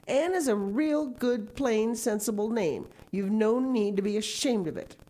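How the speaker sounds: brisk sensible Marilla. A narrator's reading. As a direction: set her straight plainly. Marilla lectures.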